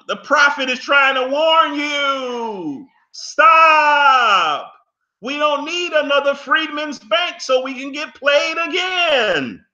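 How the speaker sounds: high, drawn-out shout of warning; crying out. Real, not acted. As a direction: cry it out like a prophet's warning. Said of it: "I'm crying out".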